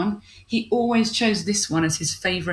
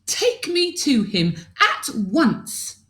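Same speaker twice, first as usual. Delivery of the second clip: higher, slower demanding princess; 6 semitones higher, slower than usual. Acted. Princess ordering the aliens, higher and demanding. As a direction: insist on it, slowly. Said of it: "demanded Princess Spaghetti".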